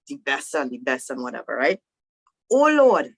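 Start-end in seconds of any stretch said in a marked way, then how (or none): 2.5-3.1 loud, higher plea to God; pleading. Retold or imitated. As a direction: imitate Moses pleading with the Lord. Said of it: "Moses pleaded with the Lord".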